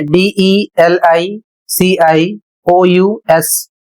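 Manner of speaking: very slow, letter by letter; spelling out. Shown as a demonstration. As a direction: spell it slowly in paired letters. Teacher spells out a word. (बहुत धीरे, अक्षर-अक्षर)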